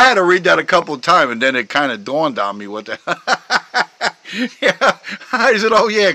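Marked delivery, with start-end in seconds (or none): 3.0-4.6 high laugh. Real, not acted. Laughs at joke. 4.6-6.1 laughing while talking; words through a laugh. Real, not acted. Laughing at joke.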